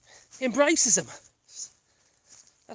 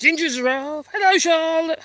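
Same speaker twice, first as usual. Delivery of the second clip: pet talk; 7 semitones higher, 6 dB louder than usual. Real, not acted. Greeting pets.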